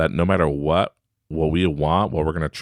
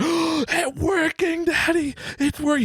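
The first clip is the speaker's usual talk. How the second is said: put-on very high, slow awed child voice; amazed son; slower than usual. Son amazed at coins, slow high awed child voice. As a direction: gasp it out in wonder. Son gasps, trick succeeds.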